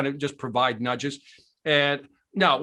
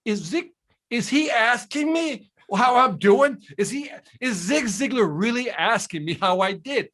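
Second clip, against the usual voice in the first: high, incredulous disbelief; can't believe it's happening; stunned disbelief; 7 semitones higher than usual. Retold or imitated. Stunned that his idol cares.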